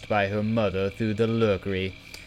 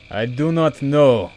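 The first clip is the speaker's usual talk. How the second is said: stammering; 5 semitones higher, 6 dB louder than usual. A narrator's reading. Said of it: "Hugo stammered".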